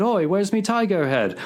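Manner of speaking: a hint of a wailing Cockney shout. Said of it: "a voice began to wail".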